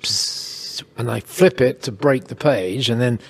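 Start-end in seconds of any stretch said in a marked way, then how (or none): 0.0-0.8 soft unvoiced psst of a silent printer; printer hiss. Showing the accountants the silent thermal printer, a soft hiss. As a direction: breathe out a quiet hiss like the printer. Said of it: "the printer goes".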